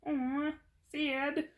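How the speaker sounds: high, drawn-out mock pity; put-on silly voice. Reader revealing a sad card, silly put-on voice. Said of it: "making all these voices".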